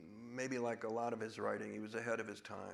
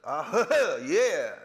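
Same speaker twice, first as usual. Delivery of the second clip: higher, louder, overblown Southern drawl as Charlie Croker; big Southern accent; 8 semitones higher, 11 dB louder than usual. Shown as a demonstration. Actor shows how Charlie comes in big, in a hammy Southern accent. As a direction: ham up a larger-than-life Southern accent.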